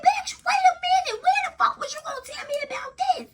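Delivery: high laughing shout of disbelief; shocked laughing outcry. Retold or imitated. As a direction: cry out in laughing shock at the news. Shocked by friend's news, laughing.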